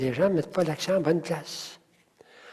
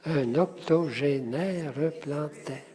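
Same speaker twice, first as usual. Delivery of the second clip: slow, softer count through "Un octogénaire plantaire"; syllable by syllable; 7 dB quieter than usual. Shown as a demonstration. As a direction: separate every syllable of "Un octogénaire plantaire", unhurried. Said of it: "Combien est-ce qu'il y a de syllabes". (syllabe par syllabe, lentement)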